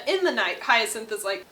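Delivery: ordinary talk, nothing marked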